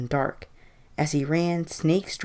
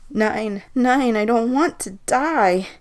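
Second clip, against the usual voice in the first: put-on high, loud German-accented plea; pleading, German accent; 8 semitones higher, 6 dB louder, slower than usual. A narrator's reading. Old man begging the voices for his life, German-accented pleading. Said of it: "pleaded in his thick German accent".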